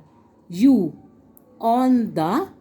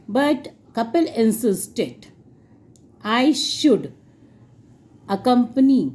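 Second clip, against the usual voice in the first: slowly, word by word for students; slow dictation; much slower than usual. Shown as a demonstration. Teacher dictating the answer to her class, slowly. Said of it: "मैं इसलिए slowly बोल रही हूँ".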